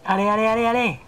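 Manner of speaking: high urgent cheering. Pretend. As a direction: egg the troops on. Cheering troops on.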